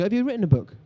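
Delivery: ordinary talk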